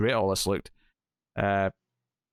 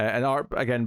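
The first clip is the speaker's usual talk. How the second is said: ordinary talk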